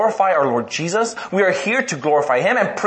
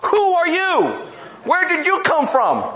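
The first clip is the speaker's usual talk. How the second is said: shocked parent; 9 semitones higher than usual. Retold or imitated. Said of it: "You're like".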